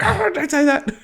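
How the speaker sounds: high-pitched imitation of fretting people; alarmed mock reaction. Man mimics everyone reacting to him tempting fate, mock alarm. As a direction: recoil in exaggerated superstitious alarm, voice shooting up. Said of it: "everyone's like".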